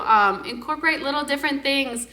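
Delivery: ordinary talk, nothing marked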